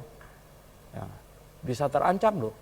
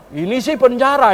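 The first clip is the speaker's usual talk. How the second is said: laughing quote; 8 semitones higher, 9 dB louder than usual. Retold or imitated. Boy realises it's a prison.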